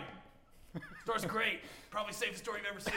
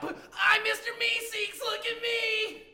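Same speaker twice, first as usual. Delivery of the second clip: higher, louder, slow stretched eager announcement; drawn-out cartoon helper shout; Mister Meeseeks; 10 semitones higher, 6 dB louder, much slower than usual. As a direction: announce yourself with eager slowness. Second Meeseeks pops out.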